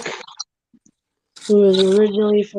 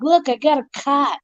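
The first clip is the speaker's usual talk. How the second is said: imitated Boston accent, pitched up; thick Boston "car" as "cah"; 5 semitones higher than usual. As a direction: do the Boston guy, R dropped. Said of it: "no mistaking that he was originally from Boston".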